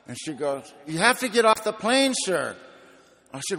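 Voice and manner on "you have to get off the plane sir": high, loud insistent demand, dropping on "sir"; perturbed flight attendant. Retold or imitated. Attendant repeats her order to a confused passenger, loudly perturbed. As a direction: mimic an attendant who has had enough. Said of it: "she's looking at me very perturbed".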